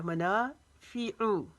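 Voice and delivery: मनाफ़िउ slow, ना long, फ़ी and ऊ short; slow recited मनाफ़िउ; tajweed recitation. Shown as a demonstration. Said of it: "फ़ी को लंबा नहीं करेंगे".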